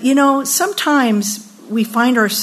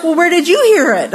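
high, quick question thrown back; challenging comeback; 7 semitones higher, 4 dB louder, faster than usual. Retold or imitated. Daughter asking mother where she heard it, challenging. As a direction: imitate a daughter firing the question straight back.

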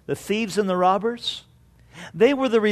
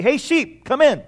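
higher-pitched farmer's call to his sheep; 6 semitones higher than usual. Acted. Farmer shows youth pastor how he calls sheep, calling out. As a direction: call the sheep in, voice lifted high. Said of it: "special way that you call him".

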